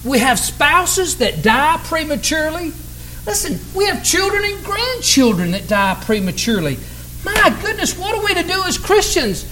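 high-pitched lamenting preacher; pained, high questioning; anguished plea. Acted. Preacher voices believers' anguish over losses.

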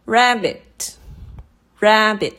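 rabbit with RAB pitched high, bit dropped; stress on first syllable. Shown as a demonstration. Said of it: "这个ra的声音就是提高了嘛".

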